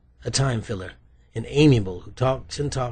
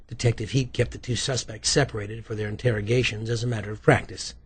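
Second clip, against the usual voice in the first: ordinary delivery, nothing marked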